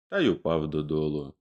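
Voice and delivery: usual speaking voice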